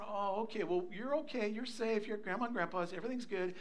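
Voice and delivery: put-on calm a frightened child quietly; gentle reassurance. Grandpa comforting scared grandchild at night, soft and reassuring.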